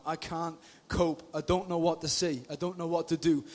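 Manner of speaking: low, soft, defeated voice; acted despair. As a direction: sound like there is nothing left.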